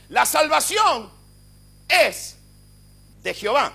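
shouted high and slow, salvación stretched out; same phrase now preached at full force; fiery proclamation. Shown as a demonstration. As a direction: declare "La salvación" as a triumphant roar. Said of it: "le voy a decir lo que significa".

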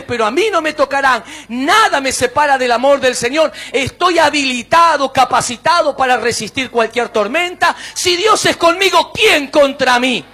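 loud, building preacher's proclamation; faith declaration. Acted. Believer declaring he can do all in Christ, loud preaching. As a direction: proclaim it boldly at full force.

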